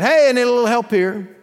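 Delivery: mock call across the house. Child calling a parent for a little help, calling out. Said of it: "calling out".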